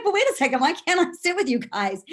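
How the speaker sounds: high, loud, disbelieving protest; indignant surprise. Retold or imitated. Retold protest at lunch table.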